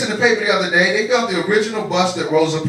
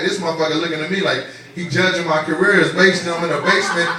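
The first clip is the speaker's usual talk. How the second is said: ordinary delivery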